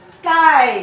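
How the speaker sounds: Sky loud with a long eye; ringing classroom demo of Sky; stretched I sound. Shown as a demonstration. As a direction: hold the eye in Sky, projecting. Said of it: "I sound".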